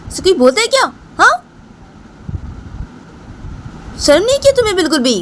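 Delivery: a shrill-free but very high, offended scolding; high-pitched scolding wife. Retold or imitated. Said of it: "तो राम बोलती".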